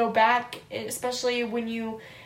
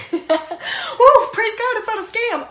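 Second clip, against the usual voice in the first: high, loud laughing whoop; giddy hammed-up praise; mock celebration; 11 semitones higher, 9 dB louder, slower than usual. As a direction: cheer like a relieved winner.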